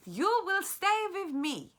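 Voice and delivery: put-on a firm promise from an old woman; grandmother. Grandmother comforts the boy.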